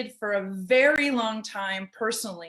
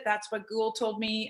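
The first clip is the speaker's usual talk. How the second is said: ordinary delivery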